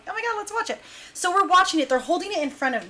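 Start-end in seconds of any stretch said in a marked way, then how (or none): 0.1-0.8 high, hushed aside; low-key eagerness. Retold or imitated. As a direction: imitate a secretly keen group, soft and high. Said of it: "everyone's low-key like".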